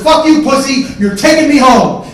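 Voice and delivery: high, full-volume yelling; angry screamed demand. Retold or imitated. Brother demanding a ride home, screaming in anger. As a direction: scream it as a furious order.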